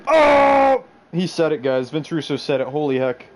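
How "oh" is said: a sudden loud high "Oh!"; surprised shout. Real, not acted. Streamer reacting to chat with a surprised shout. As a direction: blurt it out in shock.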